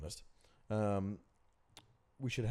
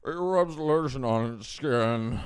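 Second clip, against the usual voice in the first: high, loud, slow drawling Jimmy Stewart take on the creepy line; Jimmy Stewart doing Buffalo Bill; 11 semitones higher, 11 dB louder, much slower than usual. Retold or imitated. Doing Jimmy Stewart as Buffalo Bill for podcast friends. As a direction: stretch it slow and high like an old film star. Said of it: "that was Jimmy Stewart doing Buffalo Bill".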